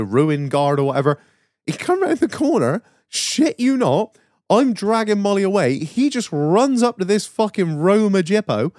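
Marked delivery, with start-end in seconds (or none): none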